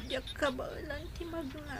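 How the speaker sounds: very soft, quick and drained; faint weak question. Acted. Sick daughter asking her mother, faint and quick. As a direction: ask weakly, hardly there.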